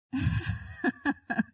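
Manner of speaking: short embarrassed laugh; real embarrassed laugh at herself; sheepish laugh. Real, not acted. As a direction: laugh at losing her place. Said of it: "I've lost where I'm up to".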